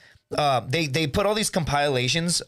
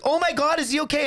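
much higher mock-concerned voice; imitated worried woman; 12 semitones higher than usual. Woman finding a passed-out backpacker, mock alarm. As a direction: play a girl fretting over someone. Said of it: "And the chick's like".

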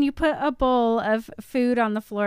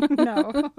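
normal talking voice

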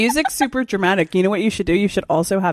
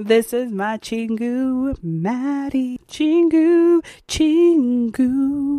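slow, higher sing-song introduction; playful intro; 5 semitones higher, much slower than usual. Pretend. Co-host practices intro with friend, slow sing-song. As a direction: draw out CHINGU, rising high at the end.

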